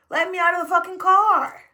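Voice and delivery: fed up with the driver, demanding out; angry demand. Retold or imitated. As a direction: snap it, at the end of your rope.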